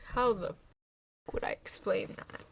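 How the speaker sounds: whispering. Real, not acted. Muttered aside.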